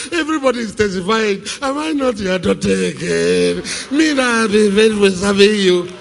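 mocking a believer crying to God about a car; mock whining prayer. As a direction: whine at God like a spoilt child. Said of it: "is crying about car, car".

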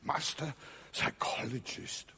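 whispered, wry aside on master psychologist; ironic whisper. Real, not acted. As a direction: breathe it out mockingly. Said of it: "Isn't that funny?".

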